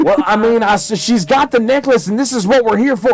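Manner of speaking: high, loud protest; defensive Griff. Acted. Agent justifies tackling her to his partner, defensive and loud.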